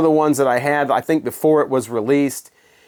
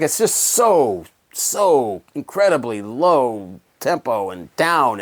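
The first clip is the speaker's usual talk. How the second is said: mock draggy slowness; 2 semitones lower, much slower than usual. Mocking how slow the song is.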